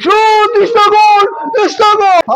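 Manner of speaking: high, loud goal scream; screaming football commentator. Real, not acted.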